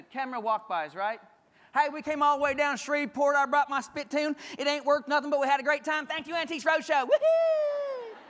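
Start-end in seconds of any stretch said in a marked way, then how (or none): 1.7-8.2 higher, louder folksy guest gushing; hammed-up excited Roadshow guest. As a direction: play a thrilled Southern visitor cheering the camera.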